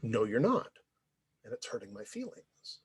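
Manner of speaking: low, soft, honest objection; quietly hurt. Retold or imitated. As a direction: state the hurt quietly, letting it sink. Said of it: "responded from a position of, of hurt".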